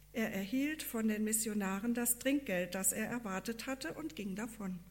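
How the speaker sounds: plain reading voice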